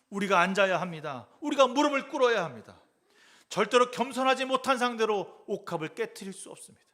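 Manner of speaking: loud, high, driving sermon voice; preaching at full force. Acted. Pastor exhorting the congregation to break their pride, fervent preaching. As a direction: urge the congregation, rising to a peak.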